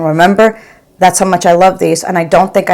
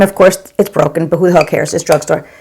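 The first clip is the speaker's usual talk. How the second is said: ordinary talk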